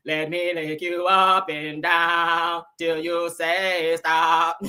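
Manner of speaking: slow, flat robot voice talking aggressively; robotic Martian. Retold or imitated. Host mocking a Martian robot voice, slow and robotic. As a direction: drag each word out like a bossy alien machine. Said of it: "Real robotic and shit".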